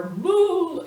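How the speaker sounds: high, loud mock hellfire "boo"; imitated explosion whoosh. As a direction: burst out with a big explosion noise.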